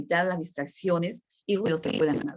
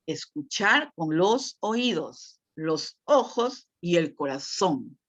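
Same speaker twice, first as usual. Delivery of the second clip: slow emphasis; much slower than usual. Shown as a demonstration. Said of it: "Esta es la parte empática".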